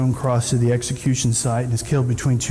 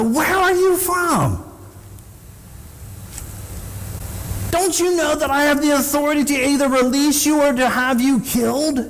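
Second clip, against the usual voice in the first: menacing Pilate; 11 semitones higher than usual. Retold or imitated. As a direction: lean in and demand an answer. Pilate interrogates Jesus privately.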